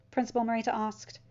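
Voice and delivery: plain reading voice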